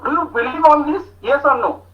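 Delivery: normal talking voice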